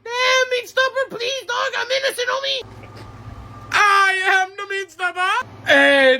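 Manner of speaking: normal talking voice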